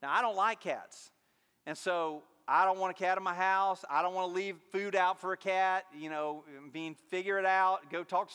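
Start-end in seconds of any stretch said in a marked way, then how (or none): none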